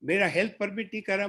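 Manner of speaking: usual speaking voice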